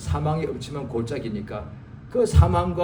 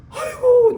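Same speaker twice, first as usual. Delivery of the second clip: laughing mock wail; 7 dB louder, slower than usual. Pastor voiced facing death.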